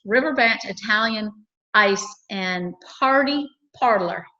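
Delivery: slow down through the business name; careful word-by-word pacing of a tricky name. Real, not acted. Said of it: "I have to say that slow".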